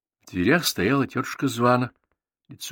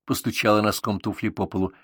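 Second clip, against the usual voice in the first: ordinary delivery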